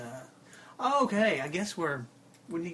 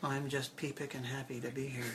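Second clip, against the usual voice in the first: soft, quiet folksy radio guest; calm hushed public radio voice; 5 semitones lower, 8 dB quieter than usual. Pretend. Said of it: "After your best public radio voice".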